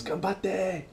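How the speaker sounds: high, soft little cheer; encouraging students. Retold or imitated. Teacher to students at a gymnastics tournament, high soft encouragement. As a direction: cheer lightly, high and quiet. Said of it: "I said it to the students".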